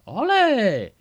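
high, loud drawn-out cheer; hammed-up triumphant olé; mock celebration. Parent cheering child's English word, mock celebration.

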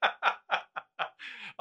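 high, laughing through the words. Real, not acted. Teasing co-host who bumped the mic, laughing.

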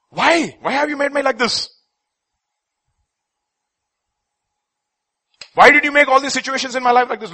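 loud, high pleading why; a man shouting his complaint at God; anguished cry. Acted. Voicing man's complaint to God.

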